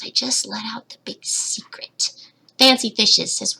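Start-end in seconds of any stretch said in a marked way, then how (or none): 0.0-2.1 hushed, playful whisper; mock-secretive whisper. Mock-confiding a secret on stream.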